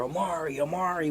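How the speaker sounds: put-on high, sing-song calling of a popular kid's name; kids calling his name. Kids at school calling out to popular Omari, high sing-song calling.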